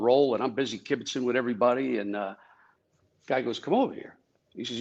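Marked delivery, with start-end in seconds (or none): 3.6-4.2 agent's call. Retold or imitated. Retold agent calling him over.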